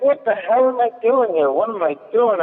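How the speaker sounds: high, despairing thought; comic at a bad gig asking himself why he's there, exasperated. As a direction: ask yourself in disbelief. Exasperated at a terrible show.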